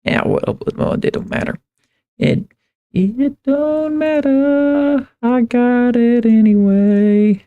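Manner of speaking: normal talking voice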